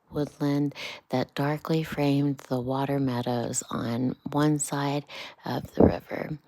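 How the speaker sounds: ordinary delivery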